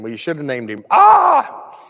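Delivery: joking yell of pain on the last word; loud comic cry of pain; mock birth scream. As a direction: let the Ah burst out like a screaming mother. Joke about the painful birth.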